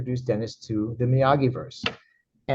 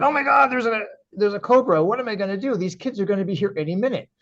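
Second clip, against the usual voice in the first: higher-pitched, alarmed retelling; mock panic; 8 semitones higher than usual. Dreamer alarmed at a cobra in his dojo, mock panic. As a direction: act out a sudden panic, pitch jumping up.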